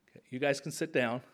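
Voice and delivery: usual speaking voice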